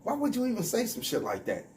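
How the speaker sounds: high, incredulous what the fuck; squeaky disbelief at a wild remark. Retold or imitated. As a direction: act stunned by what he said. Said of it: "I'm like".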